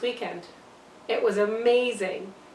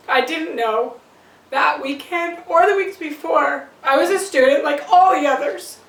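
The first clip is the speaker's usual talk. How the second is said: building to a loud, raised-pitch protest; anguished self-defence; 5 semitones higher, 9 dB louder than usual. Acted. Mother defends drinking while pregnant, protest building loud. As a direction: plead your innocence, louder with each phrase.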